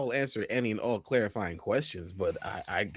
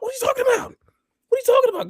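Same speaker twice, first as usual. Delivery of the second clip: squeaky disbelief; 7 dB louder, much faster than usual. Retold or imitated. Feigned clueless surprise.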